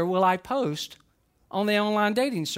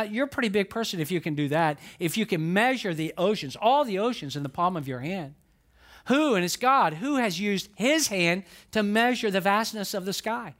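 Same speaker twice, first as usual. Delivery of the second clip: ordinary delivery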